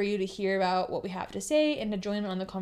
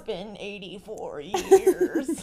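put-on old Rose voice; 6 dB louder, much slower than usual. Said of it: "you are Rose".

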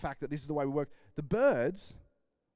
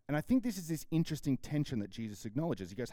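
ordinary talk, nothing marked

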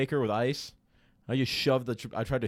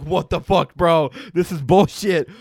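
loud, higher-pitched exasperation; retold exasperated outburst; 4 semitones higher, 9 dB louder, slower than usual. Bartender retelling his reaction to being watched, exasperated. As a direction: blurt it out, fed up and indignant.

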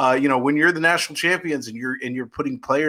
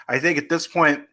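ordinary talk

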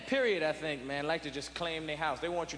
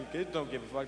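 ordinary delivery

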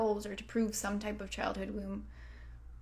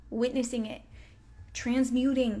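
usual speaking voice

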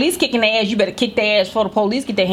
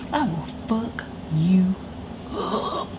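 slow, soft, breathy threat; menacing whisper; 8 dB quieter, much slower than usual. Retold or imitated. Mother quietly threatening her daughter in a slow whisper. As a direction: hush it down so it lands scarier.

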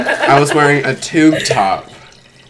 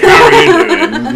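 laughing high voice; 10 dB louder than usual. Retold or imitated. Retold pickup line, laughing.

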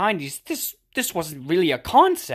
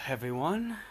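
usual speaking voice

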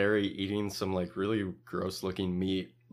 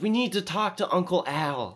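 high-pitched confrontational quote; hammed-up high insistence; mock insistent demand; 9 semitones higher than usual. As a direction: demand it like an upset camper. Imitates Billy's demand to counselor.

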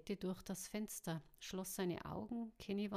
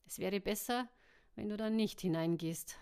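ordinary delivery

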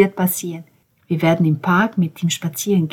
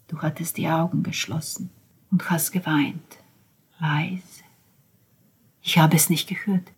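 hushed; 9 dB quieter than usual. Acted. Hushed recollection.

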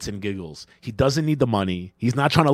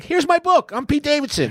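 mock Pete; 14 semitones higher than usual. Mocking Pete Davidson unveiling a book in a high voice. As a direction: present it like a goofy proud author. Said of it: "he's gonna be like".

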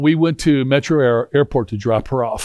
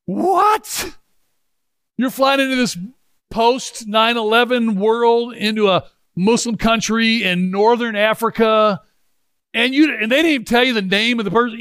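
incredulous ranting; 7 semitones higher than usual. Retold or imitated. Father ranting at his daughter over her unknown airport pickup, high incredulous rant. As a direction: rant at her, stunned she'd go so blind. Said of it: "I'm just ranting and raving".

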